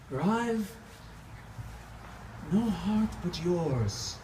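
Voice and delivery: impassioned theatrical recitation swinging from hush to outcry; dramatic monologue. Acted. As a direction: pour it out like a tormented vampire mourning his wife. Performs own Dracula monologue.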